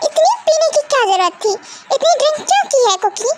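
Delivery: high, fast scolding; scolding mother. Acted. Mother scolds the drunk daughter, high and quick. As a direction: demand an answer.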